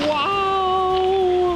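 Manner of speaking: high, loud wow; amazed. Real, not acted. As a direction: gasp at the view. Seeing the view.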